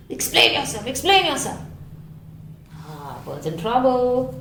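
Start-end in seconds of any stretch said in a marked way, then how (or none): 0.1-1.6 squawking crowd. Acted. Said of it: "hissed and squawked".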